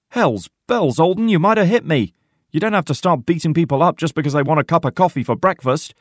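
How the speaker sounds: high, loud, quickening complaint; outraged protest. A narrator's reading. Porter nearly hit by the rifle butt, outraged protest. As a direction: yelp in indignation.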